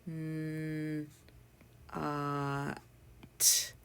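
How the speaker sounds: "N, uh, t" as isolated sounds with gaps; sounded out. Shown as a demonstration. Teacher spells not for learners, "N, uh, t" segmented. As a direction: hold each sound of "N, uh, t" on its own. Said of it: "And the spellings are".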